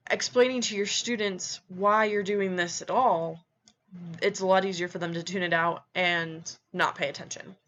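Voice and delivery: ordinary talk, nothing marked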